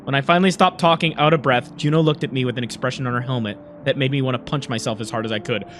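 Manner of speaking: story narration. A narrator's reading.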